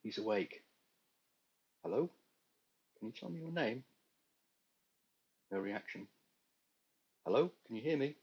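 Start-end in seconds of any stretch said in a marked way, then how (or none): 1.8-3.8 calling out to patient. Acted. Voice calling to unconscious man. 7.2-8.2 higher, louder call to an unresponsive patient. Acted.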